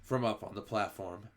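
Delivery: ordinary delivery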